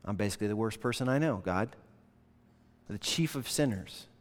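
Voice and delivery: Paul's hushed self-accusing prayer; humble confession. Retold or imitated. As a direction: confess it quietly to God. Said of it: "Paul later prays and says".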